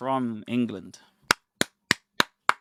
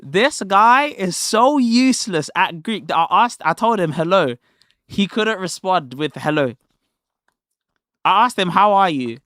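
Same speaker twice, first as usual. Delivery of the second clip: louder and higher, gleefully ridiculing; excited mockery; 4 semitones higher, 6 dB louder than usual. Real, not acted. Streamer loudly mocks the opponent for failing at Greek, excited. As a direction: pile it on with loud glee.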